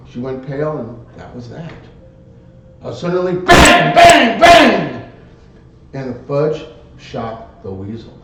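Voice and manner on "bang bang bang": loud sharp shouted bangs; gunshot bangs. Retold or imitated. Narrator acting out the fudge shooting the weasel, loud bangs. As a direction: fire off each bang hard.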